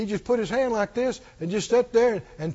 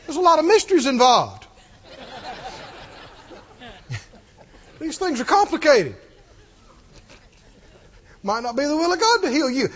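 raised, preachy scolding, hammed up; mock reprimand; 4 semitones higher, 4 dB louder than usual. Imagined reprimand of the healed woman, mock scolding. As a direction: scold like a pompous theology expert. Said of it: "so he could reprimand her".

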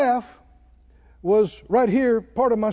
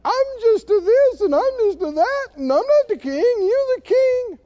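mock crying; 5 dB louder than usual. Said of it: "everybody cried and said".

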